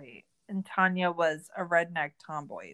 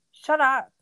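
louder, higher burst of annoyance; exasperated shout; 6 semitones higher, 10 dB louder than usual. Real, not acted. Host ranting at Tanya through the screen, loud exasperation. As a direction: snap it at her, fed up.

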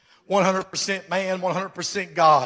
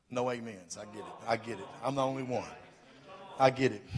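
wry aside; 8 semitones lower, 13 dB quieter, faster than usual. Real, not acted. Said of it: "no response from congregation".

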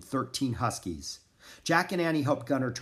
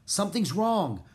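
high, mildly raised alarm; crying out; 7 semitones higher than usual. A narrator's reading. Annie warning Jack of trouble, crying out. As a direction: call out a worry. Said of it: "cried Annie".

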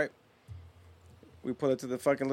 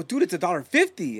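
higher, louder retold protest; incredulous at the pettiness, voice raised; exasperated; 5 semitones higher, 6 dB louder than usual. Retold argument over small bill.

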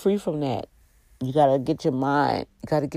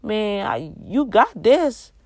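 loving reassurance to her man; 6 semitones higher than usual. Pretend. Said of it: "Let him know".

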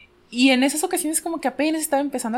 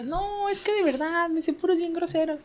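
pleading self; 8 semitones higher, 5 dB quieter than usual. Retold or imitated. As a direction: beg off sheepishly. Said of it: "Y yo".

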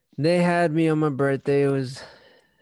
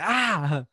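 retold yell; 6 semitones higher than usual. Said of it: "and I was like".

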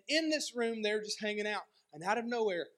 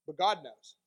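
usual speaking voice

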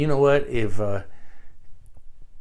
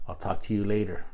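dry sign-off; 6 semitones lower, 9 dB quieter than usual. Retold or imitated. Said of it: "And it says".

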